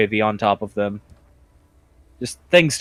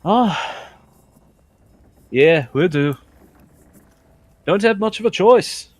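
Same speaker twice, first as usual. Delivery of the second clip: lightly voiced innkeeper, resigned, pitched up; orcish innkeeper; 7 semitones higher than usual. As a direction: lightly play a put-upon tavern owner.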